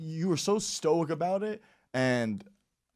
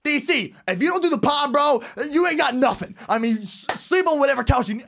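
loud, high urgent pleading; pep talk; 9 semitones higher, 4 dB louder than usual. Retold or imitated. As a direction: push him hard, raised voice. Retold pep talk to friend.

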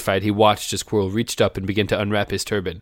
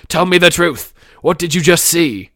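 raised, high-pitched interrogation; shouted demand; 7 semitones higher, 6 dB louder than usual. Acted. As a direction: demand it loudly and urgently. Acting shouting Quirrell interrogating Harry.

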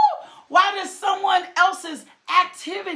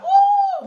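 sudden high preacher's whoop; high ecstatic whoop; 4 dB louder than usual. Real, not acted. Preacher whooping to the congregation, high joyful cry. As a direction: cry out in joy.

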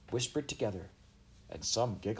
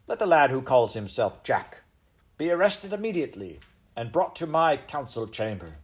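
higher-pitched royal decree; commanding; 4 semitones higher, 5 dB louder than usual. A narrator's reading. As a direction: issue a firm order as monarch. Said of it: "commanded the Queen".

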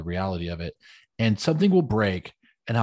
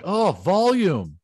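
high, loud, slow oh volume; drawn-out volume call; 9 semitones higher, 6 dB louder, much slower than usual. Retold or imitated. Someone watching too loud, a slow loud volume call. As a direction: stretch it out, higher and louder. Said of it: "I'm like".

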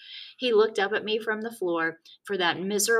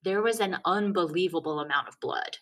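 ordinary delivery

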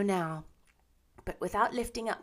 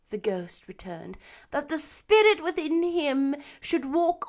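mournful ghost; 4 semitones higher, 11 dB louder than usual. A narrator's reading. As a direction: lament like a chained spirit doomed to wander. Marley's ghost voiced throughout.

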